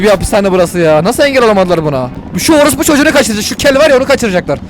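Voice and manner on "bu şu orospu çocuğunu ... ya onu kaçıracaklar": high, fast agitated outburst; agitated alarm. Real, not acted. Streamer spots who they are freeing, agitated alarm. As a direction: call it out urgently, pitched high. Sees who is being broken out.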